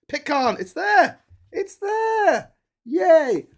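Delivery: very high, loud delighted squeals; excited squeal. Real, not acted. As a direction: squeal with glee at the find. Finds Pitcairn in the book.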